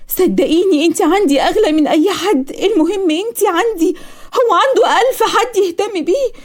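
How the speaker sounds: high, drawn-out insistence; earnest pleading. Acted. Daughter persuading her mother she matters most, pleading. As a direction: plead emphatically, stretching the words.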